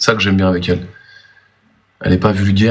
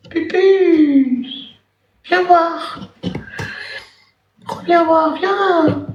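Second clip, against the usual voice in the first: very high falsetto calling; coaxing a pet back, high-pitched; yelling across the room; much slower than usual. Real, not acted. Rappelle la pupus. (appel aigu pour faire revenir)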